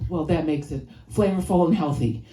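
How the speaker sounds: normal talking voice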